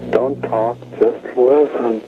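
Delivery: ordinary delivery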